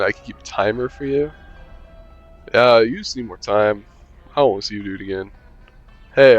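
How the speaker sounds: ordinary delivery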